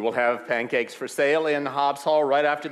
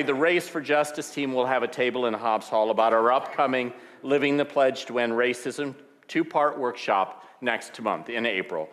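ordinary delivery

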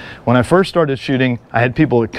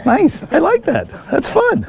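much higher, sweet mock voice of his wife; imitating wife; slower than usual. Instructor imitating his wife pleased by the choke-out, high and sweet. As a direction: play the delighted wife in a high squeak. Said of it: "But my wife is like this".

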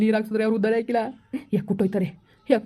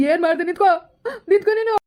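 high, tearful challenge; pitched-up cry to halt someone; anguished outrage; 8 semitones higher than usual. Acted. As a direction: call after the culprit, distraught. Calls after the fleeing culprit.

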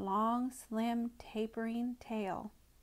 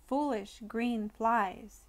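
usual speaking voice

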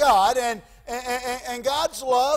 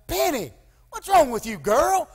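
imitating a parent's raised-pitch scolding; disappointed parent; 5 semitones higher than usual. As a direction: call out to a child in exasperated disappointment. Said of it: "I can hear Pansy now going".

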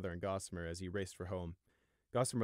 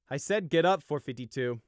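loud, higher angry order; barked; 6 semitones higher, 9 dB louder than usual. Acted. Pegasus guard ordering prisoner up: angry bark. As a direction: snap the command. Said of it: "the Pegasus barked".

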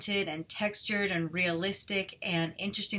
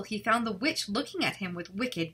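ordinary delivery, nothing marked

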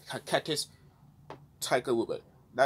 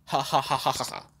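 evil laugh. Acted. Villain laughing after threat.

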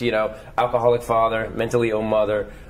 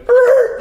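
confused audience grunt; 12 dB louder than usual. Retold or imitated. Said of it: "They went".

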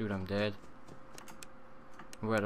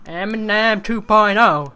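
higher and louder, landing the joke; hyped punchline; 7 semitones higher, 11 dB louder than usual. Shown as a demonstration. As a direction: announce it like a hype man. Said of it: "repeated as punchline".

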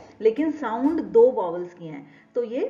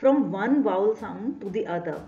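slow explanation; much slower than usual. Shown as a demonstration. Teacher explaining gliding vowels.

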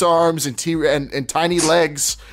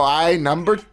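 drawn-out, slow, put-on Chicana accent; 4 semitones higher, slower than usual. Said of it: "they go".

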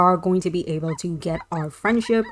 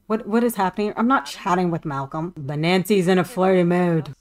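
usual speaking voice

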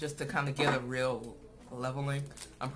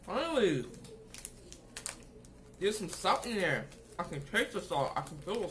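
talking between bites; chewing candy bar while speaking; mouth full, chewing; 3 semitones higher than usual. Real, not acted. Seen 2: Bites bar at -4; jaw and lips chew through early words.

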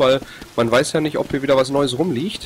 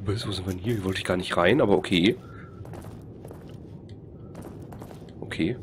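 quiet; 4 semitones lower, 10 dB quieter than usual. Real, not acted. Quiet puzzled muttering.

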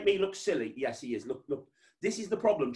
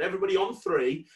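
ordinary talk

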